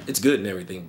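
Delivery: usual speaking voice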